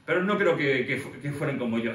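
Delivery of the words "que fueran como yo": ordinary delivery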